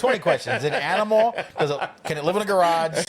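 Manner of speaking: amused, playful interrogation; laughing mock quiz. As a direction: fire off joke guessing-game questions, laughing. Joking twenty questions while laughing.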